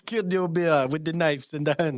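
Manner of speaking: put-on high, laughing Jamaican accent. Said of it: "I started doing a Jamaican accent".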